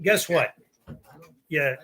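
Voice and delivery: usual speaking voice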